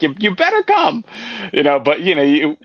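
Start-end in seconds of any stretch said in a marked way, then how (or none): 0.0-1.0 put-on voice, much higher, insisting; mock insistent friend; hammed-up pushy demand; imitating Hank. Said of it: "And he's like".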